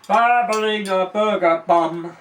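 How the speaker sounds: loud, slow, drawn-out nonsense; loud silly blurt. Acted. Comic blurting nonsense loudly at the audience. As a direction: belt it out slowly for a laugh.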